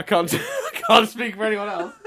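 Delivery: talking through laughter, high and slow; high, loud, laughing words. Real, not acted. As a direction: struggle to speak while cracking up. Laughing over ticket joke.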